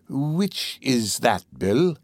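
very slow, drawn-out eager question. A narrator's reading. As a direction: ask greedily, stretching the words out.

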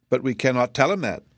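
ordinary delivery

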